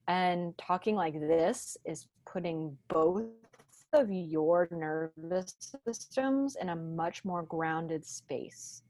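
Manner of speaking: slow, settled talk from the chest; grounded chest voice. Shown as a demonstration. Coach demonstrating calming nervous systems, grounded chest voice. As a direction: let the chest vibrate, taking it slowly. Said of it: "feel your entire chest vibrating".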